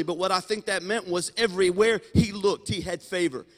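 loud, rising preacher's build; climbing, ringing sermon cadence; preaching at full force. Acted. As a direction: lift the crowd with each line.

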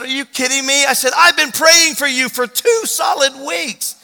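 high, loud, stretched-out amazement; building incredulous shout; amazed outcry. Retold or imitated. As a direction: cry it out, hammering each word. Amazed at meeting the grandson.

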